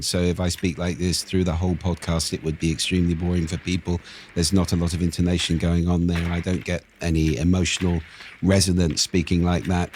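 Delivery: all on one tone, no intonation; monotone. Shown as a demonstration. Speaker shows listeners how boring one tone is, monotone. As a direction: drone it without any emotional resonance. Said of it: "literally means one tone".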